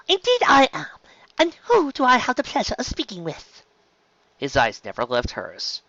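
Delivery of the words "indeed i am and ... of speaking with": high, ladylike formal voice; steady, poised voice. Acted. Nervous pony greeting the dragon, voice kept steady. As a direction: pitch it high, composed and formal. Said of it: "she kept her voice steady".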